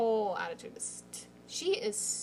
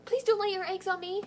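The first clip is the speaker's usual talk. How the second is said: higher, a light mock-alien delivery; Mantis quote; 8 semitones higher than usual. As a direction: quote the alien line in a playful voice. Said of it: "You say you look like an alien".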